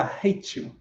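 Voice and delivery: barely voiced, near whisper; whispered hard truth to his mother; hushed confession. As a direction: breathe it out under the breath. Confession to mother, long pause.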